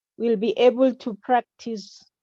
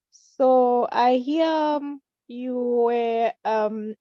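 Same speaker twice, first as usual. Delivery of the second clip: usual speaking voice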